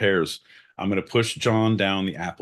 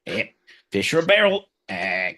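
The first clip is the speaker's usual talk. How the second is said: high, loud fake Cockney mimicry; hammed-up Cockney imitation; mock Cockney; 8 semitones higher, 6 dB louder than usual. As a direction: send up a clueless Brit, pitched way up. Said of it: "John's like".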